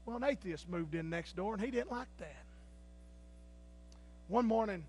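ordinary talk, nothing marked

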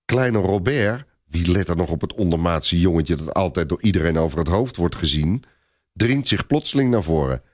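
ordinary delivery, nothing marked

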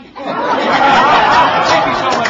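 loud, slow thanks through real laughter; laughing thanks. Real, not acted. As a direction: thank the crowd while still laughing. Signing off through his laughter.

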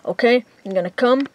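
ordinary talk, nothing marked

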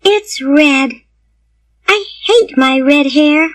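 put-on high child's voice, unhappy; sad little girl. A narrator's reading. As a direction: lament it as a small girl. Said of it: "said the little girl sadly".

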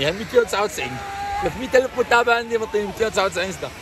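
usual speaking voice

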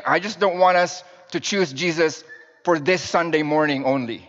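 ordinary talk, nothing marked

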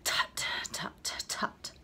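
crisp disapproving tuts; tutting. Acted. Clever boy tutting at a classmate, disapproving. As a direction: tut at the class like a know-it-all. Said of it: "tutted loudly".

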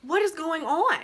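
high-pitched, confused retold question; bewildered question. Woman recalls waking up to bright lights, bewildered. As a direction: ask it high, as if waking into chaos.

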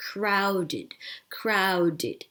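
"crowded" in two syllables, ending "-did". Shown as a demonstration. Teacher showing the ending, "crowded" with -ed said as "id". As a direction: say "crowded" slowly with -ed as "id". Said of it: "อ่าน E D ตรงนี้เป็นเสียงอิด".

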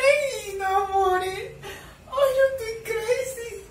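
high, whiny laughing squeal; real swooning squeal. Real, not acted. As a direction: gush over the couple. Swooning over couple.

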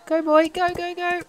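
high-pitched urging to a horse. Real, not acted.

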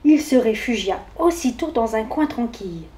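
ordinary delivery, nothing marked

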